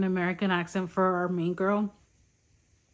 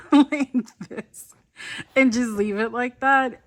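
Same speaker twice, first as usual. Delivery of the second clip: amused; 3 semitones higher, 6 dB louder than usual. Real, not acted. Showing the sticker fix.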